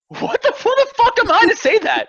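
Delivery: very high laughing disbelief; laughing outrage. Real, not acted. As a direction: laugh it out, appalled. Laughing outraged rhetorical question.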